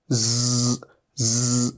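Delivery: ज़ as a voiced z with air pushed out; buzzing z. Shown as a demonstration. Teacher showing students how to breathe out the ज़ sound. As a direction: push air out on ज़ as a buzz. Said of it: "और बाहर की तरफ हवा निकालिए".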